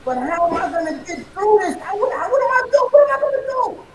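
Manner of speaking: rising to a loud, high fiery pitch; fired-up coach. Real, not acted. Motivational speaker firing up the podcast hosts, building to a shout. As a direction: build it up like a halftime pep talk. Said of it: "sounds like a halftime speech".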